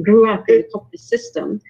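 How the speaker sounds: ordinary delivery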